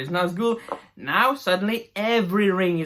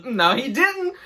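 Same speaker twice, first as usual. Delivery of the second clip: mock Disa; 7 semitones higher than usual. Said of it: "And she says".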